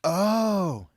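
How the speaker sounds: long, high, loud oh; collective realization. A narrator's reading. Everyone finally getting the reference, realization. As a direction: draw out a loud oh of understanding. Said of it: "said everyone".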